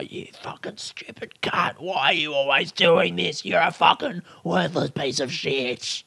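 soft, unvoiced mocking self-abuse; muttered self-abuse. Berating himself after the postcards went wrong, whispered. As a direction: hiss the insults at yourself under the breath. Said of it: "I fucking flipped out, didn't I?".

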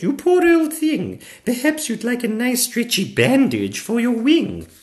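put-on high, loud sympathetic child; little girl. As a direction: commiserate in a little girl's high voice. Little girl pities Zog.

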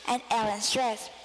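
plain reading voice

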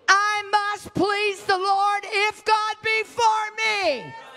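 high, drawn-out declaration of faith; impassioned preaching cry. Real, not acted. Preacher urging the congregation to please the Lord, impassioned cry. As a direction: cry it out slowly, high, stretching the words.

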